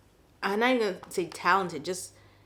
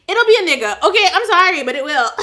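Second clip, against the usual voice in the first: loud and high outburst; 10 semitones higher, 11 dB louder, faster than usual. Real, not acted. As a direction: blurt it out loud. Blunt outburst, then apology.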